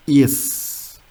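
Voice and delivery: "yes" said as "ess", the German S; German letter name. Shown as a demonstration. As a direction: hold the hissed s at the end of "yes". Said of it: "S is pronunciation as".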